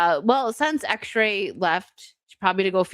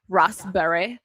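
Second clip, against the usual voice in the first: "Raspberry" the British way, "RAHS-bree"; British accent; slower than usual. Shown as a demonstration. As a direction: say "Raspberry" in a mock British accent, long first vowel. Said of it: "how the Brits say raspberry".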